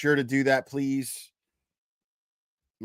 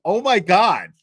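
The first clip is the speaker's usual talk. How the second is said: higher and louder, stunned; reacting to the lawyer's blunt words, higher and louder; shocked gasp; 5 semitones higher, 9 dB louder, slower than usual. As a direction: blurt it out in disbelief. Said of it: "I was like".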